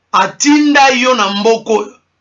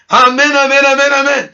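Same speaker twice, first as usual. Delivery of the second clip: excited amens; 4 semitones higher than usual. Real, not acted. Jubilant amens after laugh.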